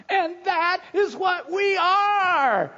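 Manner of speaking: loud, high, slow shout; excited preacher's cry; emphatic exclamation. Acted. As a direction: proclaim it like John exclaiming. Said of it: "this emphatic exclamation".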